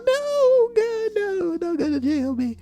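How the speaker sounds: very high whiny begging, slowing at the end; hammed-up high wailing plea; desperate pleading. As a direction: beg in a squeaky put-on voice. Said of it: "acted quarrel, pleading".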